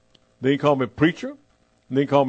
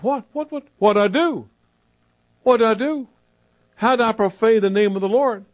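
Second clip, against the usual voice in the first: higher, flustered what-what-what; bewildered protest; 5 semitones higher than usual. Retold or imitated. Young preacher startled by the missionary's rebuke, protests. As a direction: caught off guard, defending himself. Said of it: "And I said".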